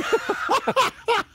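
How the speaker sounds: sudden high cackle, real; high burst of laughter. Real, not acted. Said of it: "That would".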